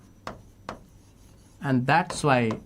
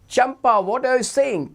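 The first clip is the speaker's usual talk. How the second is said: a lightly raised, curious pupil voice; voiced pupil question; 9 semitones higher, 3 dB louder than usual. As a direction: ask it the way a curious pupil would. Pupil voiced asking Champa.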